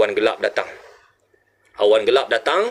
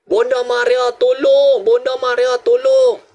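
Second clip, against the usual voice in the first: very high repeated cries, louder on the plea; crowd crying for help; 11 semitones higher, 4 dB louder, slower than usual. Retold or imitated. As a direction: imitate the drowning calling out desperately. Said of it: "orang berseru".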